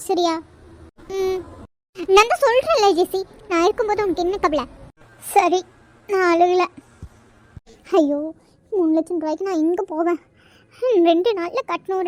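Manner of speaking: ordinary talk, nothing marked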